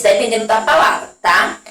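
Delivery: ordinary talk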